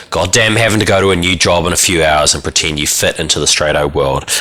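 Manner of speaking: ordinary delivery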